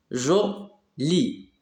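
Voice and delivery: "Joli" as two beats, jo, pause, li; split into syllables. Shown as a demonstration. Teacher finding the syllable with G, syllable by syllable. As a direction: sound out "Joli" in detached syllables. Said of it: "Entoure la syllabe où tu vois G".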